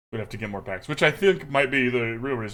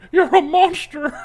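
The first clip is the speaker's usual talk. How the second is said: high, loud playful accusation breaking into laughter; hammed-up scolding cry; mock outrage; 9 dB louder, slower than usual. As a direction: wail in pretend horror at the cruelty. Mock protest at opponent's brutal move.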